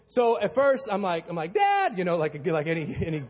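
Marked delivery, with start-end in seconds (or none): 1.5-2.0 sudden high call of Dad. Retold or imitated. Stranded son calls his father when the engine dies, high yelp. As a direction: yelp for your father in alarm.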